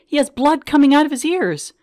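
wife yelling at the paramedics for her husband. Retold or imitated. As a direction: shout across the crowd at them. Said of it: "I'm yelling at them".

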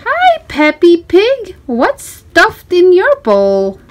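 put-on lively storybook reading for a small child. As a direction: read it like a bedtime picture book, bright and inviting. Reading picture book to child.

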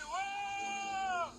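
excited whoop. Real, not acted. Sliding down in game.

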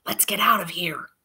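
urgent voiceless whisper; hissed whisper. Acted. Fleeing through the kitchen, urgent whisper. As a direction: hiss it so nothing hears. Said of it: "I hissed".

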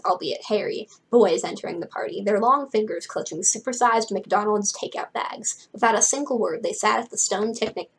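usual speaking voice

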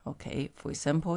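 ordinary delivery

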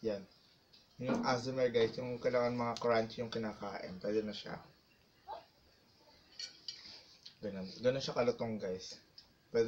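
speaking between bites; talking while chewing. Real, not acted. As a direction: mouth full of crunchy food. Seen 0: puts crispy food piece in mouth while speaking, jaw working.

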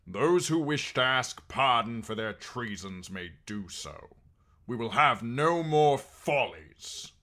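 loud, authoritative proclamation; clear, strong voice. A narrator's reading. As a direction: address the hall with firm command. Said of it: "in a clear, strong voice that silenced the murmurs".

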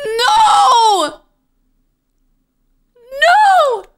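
high, loud crying no; anguished shout. Real, not acted. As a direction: wail the no in protest. Reacting to sad game scene.

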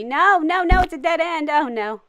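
loud, high mock panic; playful panic. Player hits a dead end mid-chase, playful panic. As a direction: cry out as if cornered. Cornered during chase in game.

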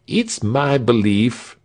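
lightly voiced thin soldier, slow start; a hint of a peevish thin guard; thin soldier Leuthold. A narrator's reading. Thin guard Leuthold complaining to Frieshardt, lightly voiced. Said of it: "said Leuthold".

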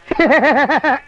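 short demonstration laugh; show-off laugh. Shown as a demonstration. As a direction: laugh to show how it's done. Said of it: "என்ற மாதிரி ஒரு நாள் சிரிக்க முடியுமா".

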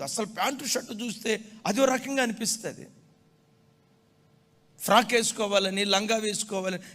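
loud, high pulpit proclamation; fervent sermon voice; full-force preaching. Acted. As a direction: preach it out to the congregation.

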